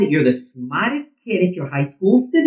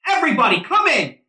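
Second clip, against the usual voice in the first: shouting; 7 semitones higher than usual. Acted. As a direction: bellow across the field. Coach calling team off field.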